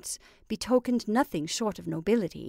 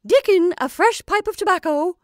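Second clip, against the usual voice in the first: much higher and louder call; sharp cry; 9 semitones higher, 10 dB louder than usual. Acted. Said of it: "cry sharply".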